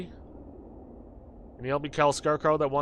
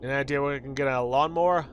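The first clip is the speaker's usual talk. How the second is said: ordinary talk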